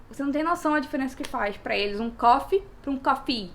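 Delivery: ordinary delivery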